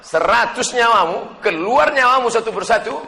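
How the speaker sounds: high, loud, emphatic defiance; impassioned declaration. Acted. Companion defies his mother's hunger threat, loud impassioned voice. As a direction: ring it out with full force.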